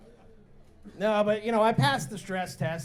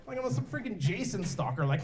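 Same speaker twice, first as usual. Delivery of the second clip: normal talking voice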